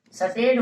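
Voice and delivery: सटेट with a vowel between स and ट; wrong state. Shown as a demonstration. Teacher imitating the wrong सटेट. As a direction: mispronounce सटेट the Haryana way. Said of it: "सटेट बोलते हैं".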